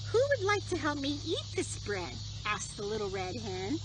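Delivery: gentle storybook reading voice; reading a story to children. Acted. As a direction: read it like bedtime story time.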